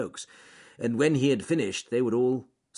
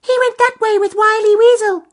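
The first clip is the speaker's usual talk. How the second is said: very high, loud twittering birds; little birds; 16 dB louder than usual. Acted. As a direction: tweet it out like two small birds. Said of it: "they said together".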